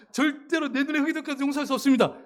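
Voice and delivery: quick, high repeat through a laugh; amused mock defiance. Pastor re-enacting the wife's refusal, laughing mock defiance. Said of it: "시험에 든 분이 있어요".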